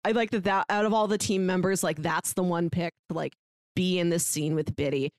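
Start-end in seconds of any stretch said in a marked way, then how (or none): none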